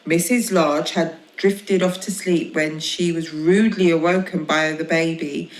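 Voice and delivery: usual speaking voice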